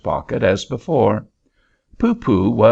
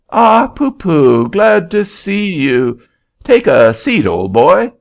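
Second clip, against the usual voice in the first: a lightly voiced jovial auctioneer, louder; hearty auctioneer; 8 semitones higher, 5 dB louder than usual. Auctioneer welcoming Poopoo, loud jovial greeting. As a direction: boom a jolly welcome.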